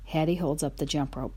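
ordinary delivery

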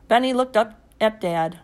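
ordinary delivery

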